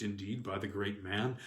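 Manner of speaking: plain reading voice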